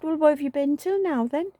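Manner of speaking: high, exasperated demand; mock indignant. Wife demanding where husband had been, high and exasperated.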